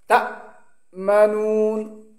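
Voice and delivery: তা cut dead on a glottal stop, then মানুন drawn out; sound shut off. Shown as a demonstration. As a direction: stop the breath sharply after তা. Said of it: "আওয়াজটা বন্ধ হয়ে যাবে". (তা-তে আওয়াজ বন্ধ করে পড়া)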